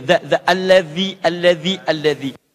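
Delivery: الذي with dhal; dhal in alladhi. Shown as a demonstration. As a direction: say "alladhi" biting the tongue tip. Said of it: "sedikit digigit lidahnya".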